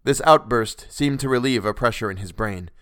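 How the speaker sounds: usual speaking voice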